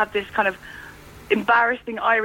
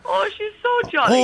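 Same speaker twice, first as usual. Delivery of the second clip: higher, slow gushing voice; mock gushing; 8 semitones higher than usual. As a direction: imitate people fussing over her. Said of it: "when they're like".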